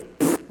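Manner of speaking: a short scornful puff of air; brushing off the prophet with a pfft; dismissive pfft. Retold or imitated. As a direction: blow him off with a pfft. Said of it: "God goes".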